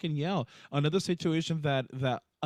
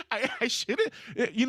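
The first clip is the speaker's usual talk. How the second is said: laughing; 7 semitones higher than usual. Real, not acted. Laughing at the friend's joke.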